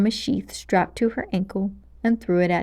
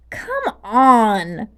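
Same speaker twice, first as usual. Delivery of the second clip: annoyed; 5 dB louder than usual. Acted.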